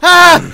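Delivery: short, loud, high scream; panicked scream. Real, not acted. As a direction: scream as the chaser catches up. Yelp while fleeing game wolf.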